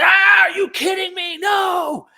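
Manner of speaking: high, loud mock outrage shout; mocking outraged commenters. Mocking angry online commenters: shrill hammed-up shout. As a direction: lampoon the triggered commenters with a shrieking yell. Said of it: "and they're like".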